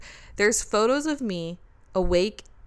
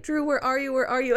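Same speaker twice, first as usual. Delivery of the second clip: imitating her drugged self calling out, higher; mock panicked calling; 6 semitones higher than usual. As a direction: call for Drew, half laughing at the memory of panic. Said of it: "And then I'd be like".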